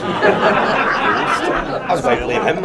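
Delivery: high, loud and slow through laughter; real laughter, drawn out and high; laughing. Real, not acted. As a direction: laugh the words out, relieved. Laughing after being startled.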